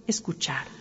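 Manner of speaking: breathy whispered word; whispering. Real, not acted. Closing invitation to listen.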